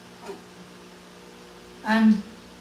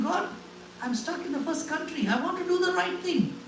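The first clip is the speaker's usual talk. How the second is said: high, pleading cry to God; retelling his anguished prayer in a high pleading voice; 8 semitones higher, 3 dB quieter than usual. Retold or imitated. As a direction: beg God, voice climbing higher. Retold desperate prayer to God.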